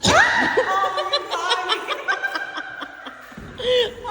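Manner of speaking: high laughing shriek of triumph; triumphant laughing shout. Real, not acted. Scare succeeded, cackling.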